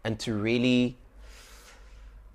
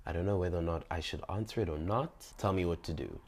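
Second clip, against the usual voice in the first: sweet hushed plea; 4 semitones lower, 9 dB quieter than usual. Retold or imitated. Sweetly asking boyfriend on phone.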